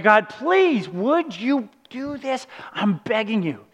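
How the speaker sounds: begging. Retold or imitated. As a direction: drag out the plea as if begging. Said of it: "this time you beg".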